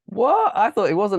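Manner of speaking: sudden high shocked "what"; surprised. Real, not acted. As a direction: caught completely off guard. Co-host shocked by the ending.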